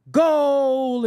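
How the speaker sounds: high, drawn-out "goal"; mock soccer cheer. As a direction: draw out goal like a soccer fan. Mocking soccer fans' goal cry.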